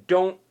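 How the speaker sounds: don't punched loud and high; stress on don't. Acted. Preacher warning the congregation, punching don't. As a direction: hit don't hard.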